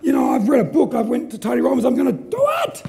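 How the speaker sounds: high-pitched, insistent mock protest building to a shout; hammed-up determination, climbing high at the end; protesting inner voice. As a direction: argue back like an over-keen self-help fan. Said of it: "And then part A says".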